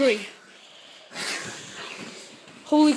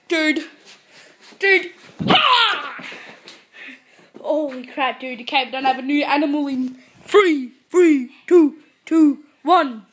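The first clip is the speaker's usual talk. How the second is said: ordinary talk, nothing marked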